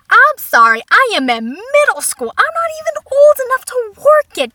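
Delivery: loud, high indignant rant; outraged protest. Acted. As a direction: yell it in hammed-up disbelief. Outraged reply to mother's remark.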